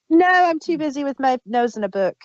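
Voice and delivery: usual speaking voice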